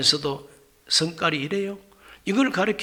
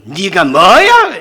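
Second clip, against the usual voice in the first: loud, slow scolding bark; mock scolding shout; 11 dB louder, much slower than usual. Pastor voicing God rebuking proud believers, a loud slow bark. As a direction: stretch it out and bellow it. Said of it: "이렇게 나올 거예요".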